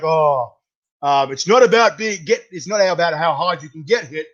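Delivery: put-on a loose, mumbly nod to Rocky's Philly accent. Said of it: "I sort of wanna throw the accent in".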